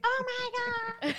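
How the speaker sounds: very high, squealing mock gush; shrill friend on phone. Imitating her friend squealing down the phone. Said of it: "'cause she'd be like".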